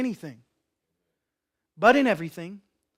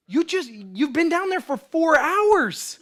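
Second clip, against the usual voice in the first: exasperated dad; 7 semitones higher than usual. Acted. Exasperated dad answers child in story.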